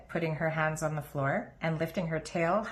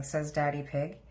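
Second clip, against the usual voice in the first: usual speaking voice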